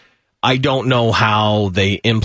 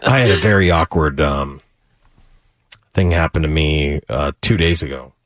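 low, subdued storytelling voice; voice dropping low; 7 semitones lower than usual. Real, not acted. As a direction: drop into a serious low tone. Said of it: "His, his voice went down".

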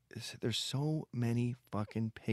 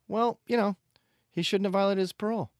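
dismissive tone; 12 semitones higher, 7 dB louder, faster than usual. Retold or imitated. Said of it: "the tone was very".

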